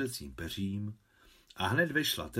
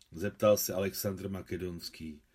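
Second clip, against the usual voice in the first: plain reading voice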